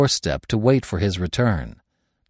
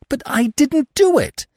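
high, eager protest, a little louder; much higher, anxious denial; sickly eagerness; 4 dB louder than usual. A narrator's reading. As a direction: plead your innocence too eagerly. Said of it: "I protested with sickly eagerness".

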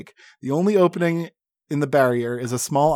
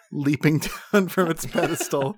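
ordinary delivery

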